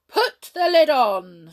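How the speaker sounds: loud, slow order; commanding. A narrator's reading. Hop ordering Jump again, slow loud order. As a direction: command it grandly. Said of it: "said Hop".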